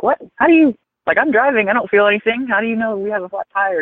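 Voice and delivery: high-pitched retold disbelief, easing down toward the end; incredulous reaction. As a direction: relive being baffled, voice jumping up high. Retold surprised reply to driver's claim.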